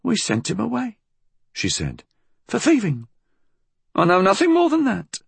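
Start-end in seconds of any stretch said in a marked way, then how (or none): 0.0-1.0 put-on high-pitched old-woman voice; high, guarded old crone; evasive old woman. A narrator's reading. Old woman evades questioning. 2.5-5.2 put-on high, quick old-woman voice; high-pitched defensive denial. A narrator's reading. Old woman evades questioning.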